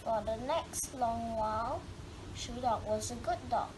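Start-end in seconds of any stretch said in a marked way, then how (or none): none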